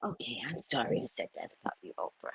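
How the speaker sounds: hushed, quick take-back; very soft, low, playful apology; mock contrite. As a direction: mutter a hasty apology under your breath. Mock apology after insulting Oprah.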